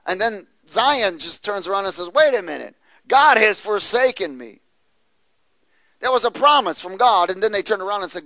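loud, rising preacher's fervour; preaching at full force. Acted. Preacher driving home Zion's doubt to his flock, at full force. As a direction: press the point hard to the congregation.

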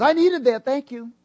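high, loud grateful outburst; gushing thanks. Retold or imitated. Shopper thanks preacher for scripture, gushing relief. Acts out woman's grateful reply.

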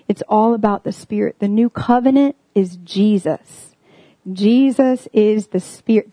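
ordinary talk

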